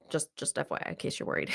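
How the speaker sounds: hushed reassurance to the listeners; a breathy confidential whisper; whispered aside. Real, not acted. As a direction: whisper it like a secret side note. Aside reassuring listeners about victim.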